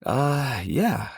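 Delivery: retold reluctant yes, starting high; hesitant admission. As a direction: drag out a high "uh" before giving in. Reluctant answer to wife, retold.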